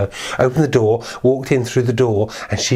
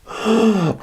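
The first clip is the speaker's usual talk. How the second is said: gasp; 12 semitones higher than usual. Acted. Before the wolf's big reveal.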